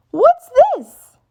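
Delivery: put-on high surprised little girl; a piglet finding something in the bush; Peppa curious. A narrator's reading. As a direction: squeak it with surprise.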